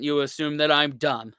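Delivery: normal talking voice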